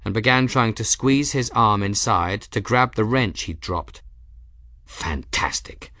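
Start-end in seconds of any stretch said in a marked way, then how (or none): 4.8-5.9 much softer, slow sarcastic whisper; under his breath, bitter; sarcastic mutter. A narrator's reading.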